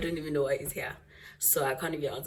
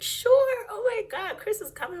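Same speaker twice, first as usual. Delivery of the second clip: high squealing mom voice, much higher and louder; excited mom; 8 dB louder than usual. Retold or imitated. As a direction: gush like a thrilled mother.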